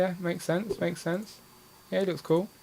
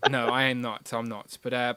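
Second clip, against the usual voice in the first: usual speaking voice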